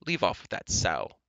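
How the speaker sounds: curt order in a haughty tone; commanding. Acted. Impatient prince to a pig keeper, commanding. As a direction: give an order expecting obedience. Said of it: "Ellidyr commanded".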